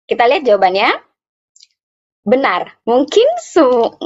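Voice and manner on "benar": normal talking voice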